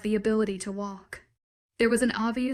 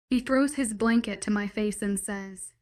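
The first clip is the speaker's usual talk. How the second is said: usual speaking voice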